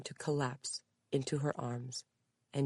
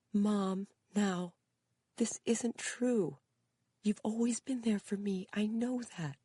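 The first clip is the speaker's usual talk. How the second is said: high, slow, gentle reassurance; calm her mother down; tender daughter; 8 semitones higher than usual. A narrator's reading. Daughter comforts crying mother.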